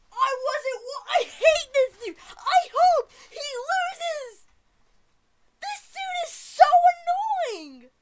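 very high, very loud tantrum; screaming rage. Real, not acted. Gamer hating dude who beat him, screaming rage. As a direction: shriek in fury at the player who beat you. Raging after being killed.

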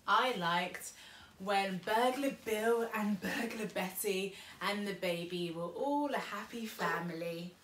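ordinary delivery, nothing marked

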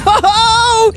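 high shrieking laugh-cry; laughing scream. Real, not acted. Falling into spikes.

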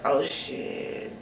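breathy whispered curse under her breath; hushed dismayed whisper; whispered oh shit. Retold or imitated. After tapping the car.

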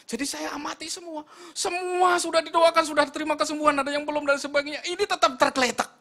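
high, shouted storytelling; full-force preaching. Real, not acted. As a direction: shout the escalating story to the congregation.

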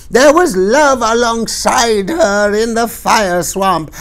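high, loud crone voice, slow and dragging; ranting old crone. A narrator's reading. Old woman denouncing the Queen to the crowd, high ranting crone voice. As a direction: denounce her to the whole crowd. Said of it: "the old woman would not be quieted".

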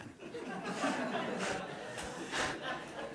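long high breathy burst of laughter; big laugh. Real, not acted. Laughing at his own story of the burly guy. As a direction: let a real laugh roll out.

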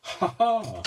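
loud laughing outburst; burst of laughter. Real, not acted. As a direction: laugh out, can't help it. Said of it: "I'm corpsing".

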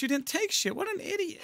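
squeaky mock insult. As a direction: tease him in a silly squeak. Mocking the villager.

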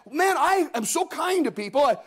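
high, aggrieved church member; self-pitying complainer. Retold or imitated. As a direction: complain in a high voice that nobody cares. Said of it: "somebody with mercy says".

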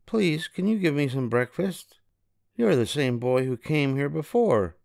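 suspicious housewife; giant woman voice. A narrator's reading. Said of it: "said the great big tall woman".

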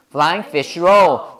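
"Flying fish roll" slow, in three separate beats; three stresses. Shown as a demonstration. Teacher drilling students on the phrase, three beats. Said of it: "고개를 세 번 움직여야 돼".

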